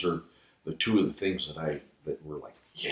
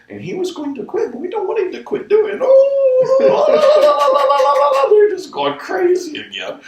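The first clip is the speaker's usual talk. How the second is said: very high, loud host voice swept up in laughter; mock host, far higher and louder, laughing through it; host translating; 12 dB louder than usual. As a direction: play the host, overjoyed, pleading to the crowd. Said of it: "And he goes".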